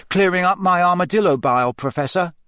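innocent schoolboy answer to a teacher; reply lightly, as if nothing is amiss. A narrator's reading. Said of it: "said Harry innocently".

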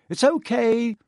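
calling up high to someone above. A narrator's reading. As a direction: call it up the shaft. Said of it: "he called up to the light".

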